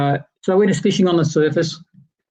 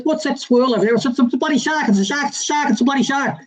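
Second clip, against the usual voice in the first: excited shark alarm; 8 semitones higher than usual. Retold or imitated. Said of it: "Colin looks up and he says".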